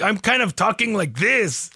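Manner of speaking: bad Mexican accent. Shown as a demonstration. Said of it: "very bad Mexican impression".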